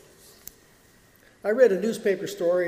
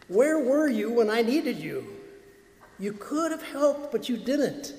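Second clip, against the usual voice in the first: higher, reproachful accusation; blaming tone; 5 semitones higher than usual. Retold or imitated. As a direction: throw the blame back at Jesus. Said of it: "in blaming tone".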